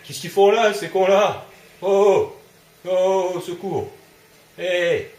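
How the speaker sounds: high, loud frightened shouts; panicked yelling. Real, not acted. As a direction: scream for help as they close in. Enemies attack, player panics.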